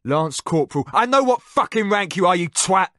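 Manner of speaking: furious high shout; drill-instructor rage at the prisoner; yelled. A narrator's reading. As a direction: scream it in his face. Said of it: "the man yelled".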